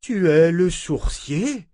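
can't believe who he is; astonished. A narrator's reading. Élisabeth asks Richard, slow, high and astonished.

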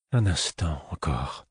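soft, slowed murmur; reassuring murmur. A narrator's reading. The fool soothing Fitz, soft reassuring murmur. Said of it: "murmura-t-il, comme pour me rassurer".

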